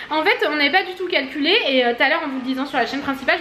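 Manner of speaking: ordinary delivery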